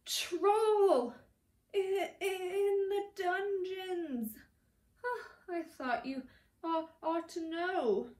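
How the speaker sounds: high, loud breathless terror; frightened stammering warning; gasped panic. A narrator's reading. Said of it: "and gasped".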